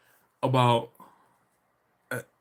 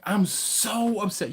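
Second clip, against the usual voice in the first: higher, drawn-out complaint; exaggerated upset; 7 semitones higher, slower than usual. Real, not acted. Streamer griping about no brown bag, playfully upset. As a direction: stretch it slowly in protest. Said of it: "You have no idea how upset I was".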